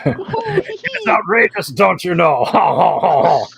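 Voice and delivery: a hammed-up French accent in reply to a viewer. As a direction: overdo a silly French accent, slowly. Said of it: "How is your French accent".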